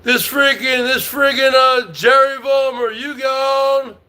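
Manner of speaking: ordinary talk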